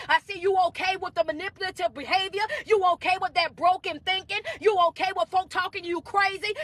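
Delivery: insistent, rhythmic preacher delivery; motivational preaching. Real, not acted. Coach urging followers to walk away, motivational preaching. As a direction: drive each repeated refrain home.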